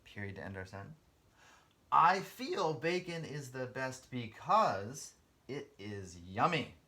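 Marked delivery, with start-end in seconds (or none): 1.9-6.7 slow emphatic reading. Shown as a demonstration. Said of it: "final reread to students".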